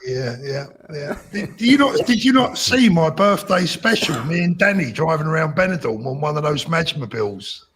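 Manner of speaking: ordinary talk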